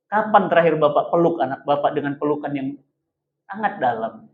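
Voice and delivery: hushed, heartfelt appeal; soft and intimate. Real, not acted. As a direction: draw the fathers in quietly. Preacher softens, asks fathers.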